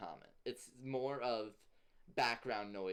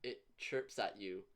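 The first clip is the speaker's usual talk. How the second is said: normal talking voice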